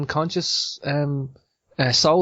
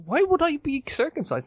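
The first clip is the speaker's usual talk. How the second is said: incredulous question; 13 semitones higher than usual. Retold or imitated. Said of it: "and I, I went".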